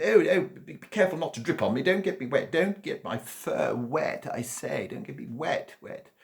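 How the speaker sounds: very high, loud fussy squeal; fussy cat. A narrator's reading. Cat squealing at the wet dog not to drip on it.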